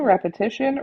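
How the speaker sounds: ordinary talk, nothing marked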